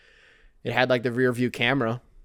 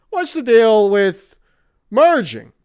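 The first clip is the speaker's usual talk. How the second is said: louder, higher standup delivery; Jerry Seinfeld bit; 7 semitones higher, 10 dB louder, slower than usual. Retold or imitated. Imitating Jerry Seinfeld as the car alarm, standup style. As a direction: do the observational comic setup. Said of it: "Jerry Seinfeld being like".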